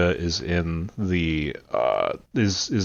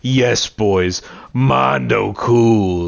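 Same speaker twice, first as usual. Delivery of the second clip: slow, drawn-out mocking echo; Vegeta's head voice; much slower than usual. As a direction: imitate a sneering inner voice, stretching the last words. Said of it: "like, Vegeta's head voice going".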